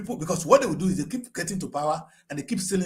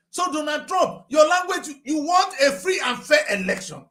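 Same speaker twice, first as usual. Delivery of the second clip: calling out; 10 semitones higher, 4 dB louder than usual. Real, not acted. Calling out to Donald Trump.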